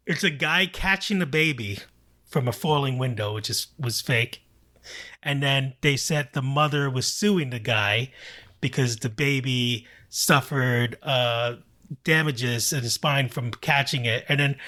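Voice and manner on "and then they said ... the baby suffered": usual speaking voice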